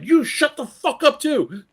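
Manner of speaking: loud, high, drawn-out retort; angry yell. Retold or imitated. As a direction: fire back hard, stretching out the words. Retold quarrel with the kid's mother.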